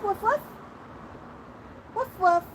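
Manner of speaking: soft, high-pitched little woofs; dog bark imitation. Owner coaxing a parrot to bark, playful soft woofing. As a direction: gently model a bark for a pet.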